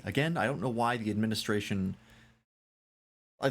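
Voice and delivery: ordinary talk, nothing marked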